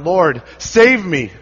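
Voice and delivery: slow, high, loud plea for rescue; desperate cry. Acted. Sinking Peter crying to his Lord, desperate shout. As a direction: cry out as you sink. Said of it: "he cries out".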